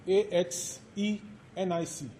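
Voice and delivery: A X E N I C spelled letter by letter; spelling out. Shown as a demonstration. Lecturer spelling the term axenic for students, letter by letter. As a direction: spell out A X E N I C clearly. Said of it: "Azenic or pure culture".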